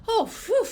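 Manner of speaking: high "oh", puffed-out phew; mock sigh of relief; relieved exhale. Clinician landing another new patient, exaggerated relief. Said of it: "And we go".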